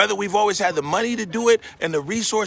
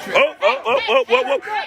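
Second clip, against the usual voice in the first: alarmed cries. Real, not acted. Shock at a bleeding man.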